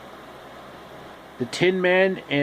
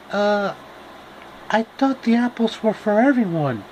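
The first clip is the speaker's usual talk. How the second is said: Dorothy voice; 7 semitones higher than usual. Acted. Dorothy answers the tree.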